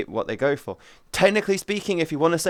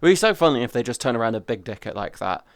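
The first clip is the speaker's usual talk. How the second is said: normal talking voice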